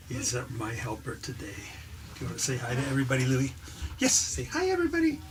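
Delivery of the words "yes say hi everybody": high squeaky voice for the dog; dog's voice. Acted. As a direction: speak for the dog in a silly high voice. Speaking for the dog.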